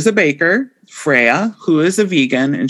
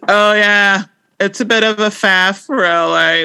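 downtrodden British accent attempt; 5 semitones higher than usual. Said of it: "Like, this is downtrodden".

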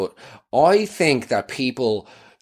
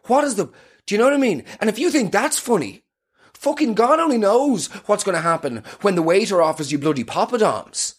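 mock outrage; 9 semitones higher than usual. Mock outrage at laughing date.

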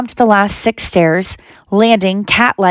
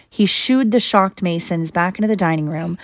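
usual speaking voice

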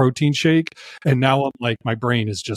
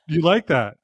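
ordinary talk, nothing marked

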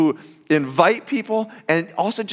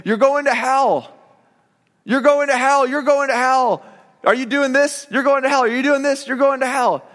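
mock street-preacher yell; 6 semitones higher than usual. Campus preacher yelling at passing students, mock yell. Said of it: "he would just yell and say".

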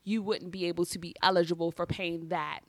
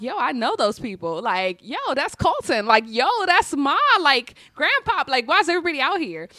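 excited recognition; 5 semitones higher, 6 dB louder than usual. Retold or imitated. Recognizing family at surprise.

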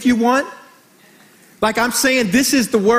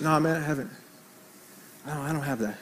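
much lower, very soft reply; sheepish admission; 8 semitones lower, 12 dB quieter, faster than usual. Retold or imitated. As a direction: admit it quietly, dropping the voice right down. Kid sheepishly admits, retold.

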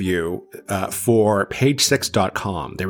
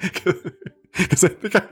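talking through helpless giggles, higher pitched; embarrassed laughing; 7 semitones higher than usual. Real, not acted. Storyteller confesses a silly remark, real embarrassed laughing. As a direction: laugh at yourself as you start explaining.

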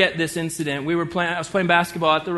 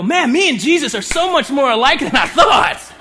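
higher, louder, laughing gush of relief; mock delighted listener; 8 semitones higher, 6 dB louder than usual. Listener pleased Jesus agrees with him, laughing loud. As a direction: play the smug churchgoer thrilled to agree. Imagined listener after fake beatitudes.

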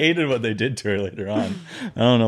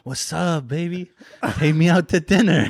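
higher-pitched imitation of a street character; mock come-on, pitched up; man from video; 7 semitones higher than usual. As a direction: imitate a cheeky interviewee flirting. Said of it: "And the guy goes".

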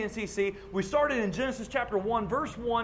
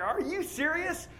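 incredulous; 8 semitones higher, 5 dB quieter, slower than usual. Retold or imitated. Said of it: "lost some of my military bearing".

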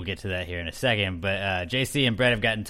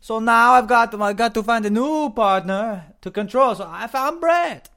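Tommy Wiseau impression; 12 semitones higher, 7 dB louder than usual. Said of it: "That was more of a Tommy Wiseau".

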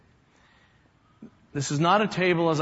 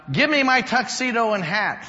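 higher-pitched stage cry; mock theatrical proclamation; 6 semitones higher, 3 dB louder than usual. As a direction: call it out like an actor delivering his big line. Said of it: "he's supposed to cry out".

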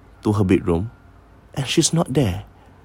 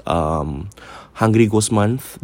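normal talking voice

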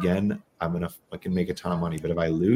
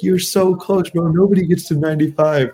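much higher and louder, mock-eager con man; pushy hustler; 10 semitones higher, 7 dB louder than usual. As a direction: egg him on like a dealer who won't let go. Mimicking dealer urging him on.

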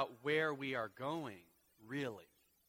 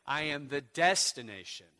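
louder I am the destination; ringing declaration; loud lead; 9 dB louder than usual. Acted. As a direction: call it out to the congregation. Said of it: "Say it with me again".

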